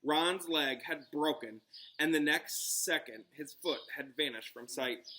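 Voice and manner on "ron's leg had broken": usual speaking voice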